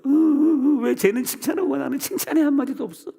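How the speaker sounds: very high, loud mock wail; hammed-up jealous whimpering complaint; resentful whining. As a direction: imitate a sulking believer crying it's unfair. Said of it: "시험 들어가지고".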